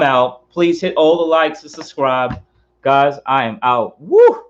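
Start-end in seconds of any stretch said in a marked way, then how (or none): 4.0-4.4 a high, loud woo after the shot; loud high whoop. Real, not acted. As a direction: cheer out after the drink. Signing off with a cheer.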